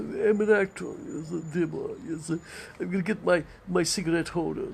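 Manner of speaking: high-pitched affected thespian; mock a precious old-school theatrical type; luvvie actor. Mocking certain theatrical actors, high affected luvvie voice. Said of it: "Certain actors".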